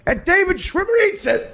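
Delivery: very high, gleeful holler; shouted topper. Acted. Comic tops his bet on the celebrities, gleefully shouting. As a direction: cry out the gross punchline.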